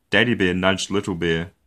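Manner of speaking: ordinary delivery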